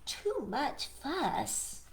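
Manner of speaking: a tired rabbit sighing inwardly; Rabbit thinking. Acted. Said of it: "poor Rabbit is saying".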